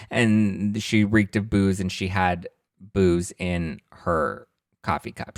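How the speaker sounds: usual speaking voice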